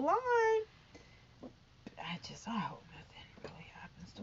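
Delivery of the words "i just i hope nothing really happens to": soft, worried whisper; whispered hope. Real, not acted. Vlogger quietly hoping the son stays safe, whispering. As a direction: breathe it out as a private wish.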